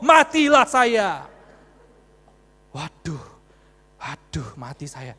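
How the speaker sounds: loud, drawn-out cry of doom; mock dismay. Preacher retelling his panic before the policeman, mock dismay. Storyteller caught out by officer's connection.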